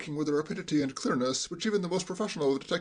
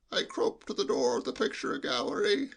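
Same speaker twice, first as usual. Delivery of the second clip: hushed, high sheep-like bleat; lowered bleating voice; 5 semitones higher than usual. Acted. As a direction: bleat it confidentially, as through cotton wool. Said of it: "in a lowered voice".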